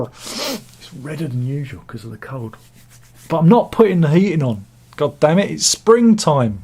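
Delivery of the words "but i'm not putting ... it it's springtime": mock outrage. Real, not acted. To viewers, refusing the heating, mock outraged. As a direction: grumble it like a stubborn Brit.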